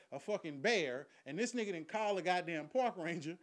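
usual speaking voice

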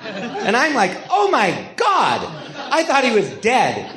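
high, slow words broken by laughter; laughing. Real, not acted. Recalling the stoned cyclist, laughing hard. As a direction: let the laugh push through each word. Laughing while retelling the crash.

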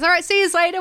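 broad Australian send-off, pitched right up; Australian customer. Retold or imitated. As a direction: imitate an Australian bloke calling goodbye. Acting out the Australian customer leaving.

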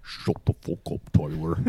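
harsh whispered quote. Retold or imitated. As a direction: hiss it under your breath. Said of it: "imitating Tyler's angry bit".

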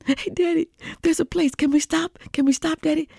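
put-on high pleading kid voice; begging little boy. Hungry boy begging his daddy to stop, high and pleading.

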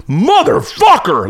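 retold curse, stretched out in outrage; angry outburst. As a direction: act out a man appalled by what he's found. Angry man discovering soiled stall.

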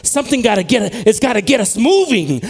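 high, loud, driving sermon voice; impassioned preaching. Real, not acted. Preacher urging church to be moved and awakened, impassioned preaching. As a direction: preach it at full force, punching the key verbs.

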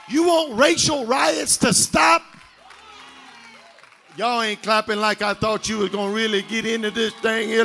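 shouted, rising sermon cadence; full-force preaching. Acted. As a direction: preach it hard to the congregation.

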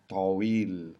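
"Tawil" with a dark T, drawn out; long "Tawil", tongue pulled back on T. Shown as a demonstration. Teacher giving the word for tall, "Tawil" with back T. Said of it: "the T that's coming from the back".

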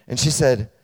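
usual speaking voice

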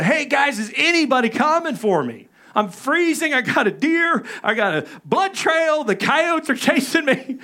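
frantic radio call to dad and brothers, coyotes closing in; panicked plea. Retold or imitated. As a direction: beg for rescue over the radio.

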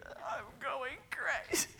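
high hushed slow desperation; high slow whisper. Retold or imitated. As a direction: whisper it slowly, losing it.

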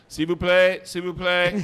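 high, loud French call; mock French umpire. Teasing a friend before serving at match point, mock French call. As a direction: sing out the French to needle a rival.

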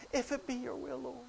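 very soft, polite little request; hushed, tentative plea; mock timid prayer. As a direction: murmur it meekly. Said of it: "He didn't say".

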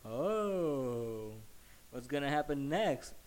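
drawn-out mock "oh" of suspense; hint of a wife watching drama unfold; onlooking wife. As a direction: lightly mimic an intrigued wife. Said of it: "his wife is kinda like".

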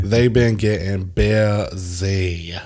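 very slow, drawn-out "busy"; mimicked movie interviewee. Friend repeats the interviewee's line for effect, slow playful mimicry. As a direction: savour each word in mimicry, dragging out "busy". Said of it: "you can mimic his voice perfectly".

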